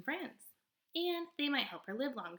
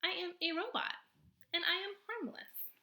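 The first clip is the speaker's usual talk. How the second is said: higher, even robot voice; 10 semitones higher than usual. Acted. Robot answering the possum in a higher, even voice. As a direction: answer plainly, like a machine.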